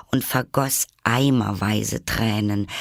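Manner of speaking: usual speaking voice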